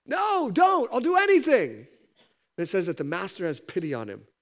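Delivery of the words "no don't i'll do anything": very high, loud begging; desperate plea. Acted. Debtor begs the master to spare his family, very high and loud. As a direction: cry out to save your wife and children.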